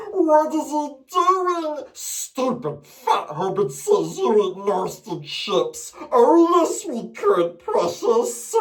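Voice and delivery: high, slow hissing creature; creepy whining creature; Gollum. Retold or imitated. Creature sulking about food, high hiss. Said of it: "which also sounds a bit like".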